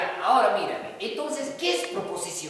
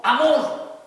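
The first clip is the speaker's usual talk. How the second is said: loud, raised demo exclamation; exclaimed emotion; 5 semitones higher, 9 dB louder than usual. Acted. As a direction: call it out as an example of emotion. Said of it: "o puede ser emoción también".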